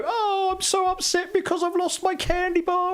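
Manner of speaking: hammed-up, very high childish whine; mock upset sibling. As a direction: imitate a kid's overblown upset in falsetto. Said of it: "when you've got a young sibling".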